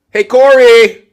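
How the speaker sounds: loud high hammed-up call; mock call. Mock argument with muted guest.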